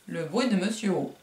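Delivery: usual speaking voice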